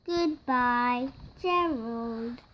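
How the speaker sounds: ordinary delivery, nothing marked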